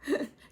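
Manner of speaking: short, high, loud laugh. Real, not acted. Host after getting through a tongue twister, laughing. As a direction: burst out laughing at yourself.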